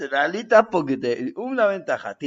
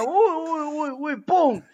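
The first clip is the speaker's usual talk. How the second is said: pained yelps; 11 semitones higher than usual. Retold or imitated. Bat crashing into walls.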